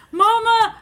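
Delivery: put-on high, loud little-kid cry for mama; imitating scared child calling. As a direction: wail like a frightened toddler.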